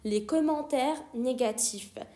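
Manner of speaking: very slow, word by word; slow repeat. Shown as a demonstration. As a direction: dictate it slowly. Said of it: "teacher repeats slowly".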